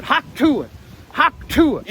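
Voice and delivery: high-pitched, punchy mock spit; mock spitting noise. Witness imitating Trump spitting out bullets, mock spit. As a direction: spit the bullets out, twice over.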